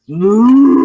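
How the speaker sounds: loud, high mock moose bellow into a helmet; hammed-up moose bellow, full volume; moose call. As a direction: bellow like a wounded moose to scare them off. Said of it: "the sounds of a moose".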